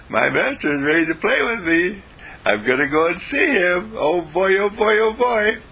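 high, excited dog calling to his friends; a happy pup hurrying home to his master; gleeful puppy, rising to a squeal on the last "oh boy"; eager dog voice. Acted. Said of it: "He'll say".

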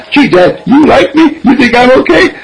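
put-on high-pitched little-boy questions; eager young son. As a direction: ask Dad hopefully, like a small boy. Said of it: "Our son says".

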